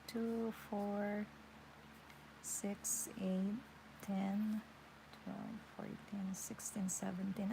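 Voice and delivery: soft, half-voiced counting; counting under breath. Real, not acted. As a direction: count quietly to yourself. Counting stitches aloud mid-lesson.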